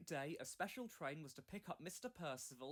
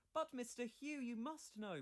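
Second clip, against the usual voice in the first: much higher, insistent protest; 8 semitones higher, 5 dB louder than usual. Acted. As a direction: plead with Mister Hugh in disbelief. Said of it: "protested Skarloey".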